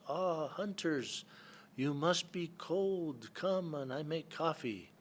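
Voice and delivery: high, slow, sing-song invitation; Eskimo lady's welcome. Retold or imitated. Old village woman calling hunters in off the river, high and slow. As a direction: draw it out high like a kindly elder lady. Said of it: "and she says".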